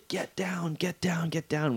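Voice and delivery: hushed, breath-heavy pretend yell; mock yelling cop. Retelling cops ordering them off the Eiffel Tower, mock hushed yell. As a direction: yell it under your breath, as an imitation. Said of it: "cops show up yelling at us".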